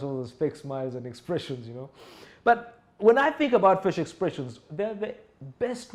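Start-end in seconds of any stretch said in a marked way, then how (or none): none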